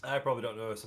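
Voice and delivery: usual speaking voice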